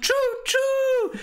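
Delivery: high squeaky choo choo; train whistle. Pretend. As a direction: toot like a toy train.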